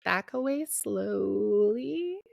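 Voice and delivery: slow, drawn-out, creeping words; slow mock retreat. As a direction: ease the words out like backing off from a beast. Joking about retreating from daughter.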